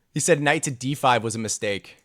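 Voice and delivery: usual speaking voice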